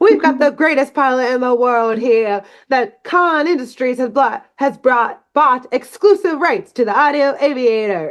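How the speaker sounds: high-pitched mid-Atlantic newsreel voice; old-timey newscaster. Acted. Podcaster voicing the newsreel about Khan Industries, old-timey newscaster. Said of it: "old-timey newscaster, like, voiceover".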